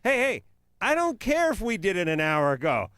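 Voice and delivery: high, loud commanding push; rally yourself like a coach; bossy pep talk. Retold or imitated. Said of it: "kind of penis where you go".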